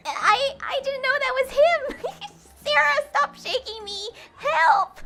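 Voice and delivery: high, loud flustered yelling; laughing shout. Acted. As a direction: cry for help while laughing. Sarah shaking [name] in excitement.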